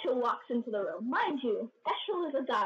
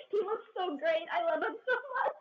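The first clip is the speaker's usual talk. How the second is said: high, squealing fan gush; rising, high gushing fangirl voice; gushing adoration; 7 semitones higher than usual. Real, not acted. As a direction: squeal how much you adore him. Gushing over character.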